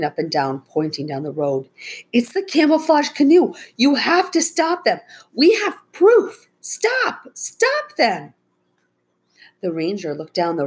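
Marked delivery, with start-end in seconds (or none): 2.1-8.3 high, loud, desperate pleading cries; a girl begging him to stop the truck; frantic urging. A narrator's reading. Becca urging the ranger to act.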